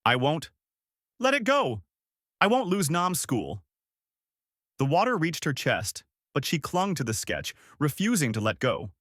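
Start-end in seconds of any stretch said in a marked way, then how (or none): 0.0-3.6 high-pitched defiant cry; understated scream of defiance; mild scream. A narrator's reading. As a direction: refuse desperately, pitch leaping up.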